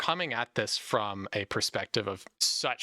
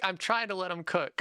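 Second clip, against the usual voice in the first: higher, mock exasperated protest; 8 semitones higher than usual. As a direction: defend yourself, fed up but joking. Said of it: "And I'm like".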